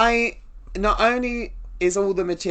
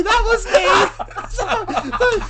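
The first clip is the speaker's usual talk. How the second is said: very slow, high and laughing; drawn-out laughing; 11 semitones higher, 3 dB louder, much slower than usual. Acted. [name] owning up again, slowly and laughing. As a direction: stretch it out while cracking up. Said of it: "Oh, sorry".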